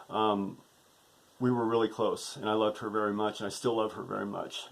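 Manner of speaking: low, soft, subdued hurt; real sadness. Real, not acted. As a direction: speak quietly of a painful estrangement. Speaks of estranged daughter in farewell.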